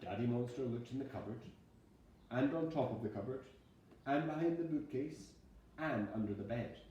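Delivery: plain reading voice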